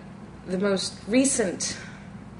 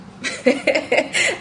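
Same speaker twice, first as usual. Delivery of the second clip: a high burst of laughter; bright laugh; 8 semitones higher, 5 dB louder than usual. Real, not acted. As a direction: laugh out loud at yourself. Laughs at own confession.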